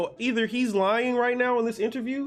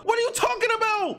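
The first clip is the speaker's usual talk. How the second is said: exasperated disbelief; 12 semitones higher than usual. Real, not acted. Angry rant at author.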